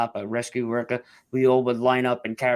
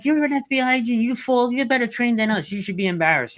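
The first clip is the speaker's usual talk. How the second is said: much higher, louder joking reproach; mock teasing scold; 12 semitones higher, 3 dB louder than usual. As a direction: tease her to make her laugh. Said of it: "I was trying to make her laugh".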